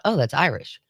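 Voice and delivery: ordinary talk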